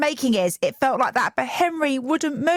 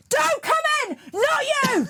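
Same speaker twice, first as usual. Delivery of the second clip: shouted. Retold or imitated. Mum shouting at her partner through the door, high shout. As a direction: bark it at him to stay out. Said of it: "I literally shouted at him".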